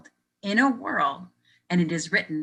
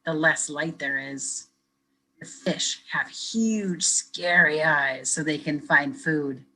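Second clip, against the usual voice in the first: slow spooky reading; much slower than usual. Acted. Spooky deep-sea description.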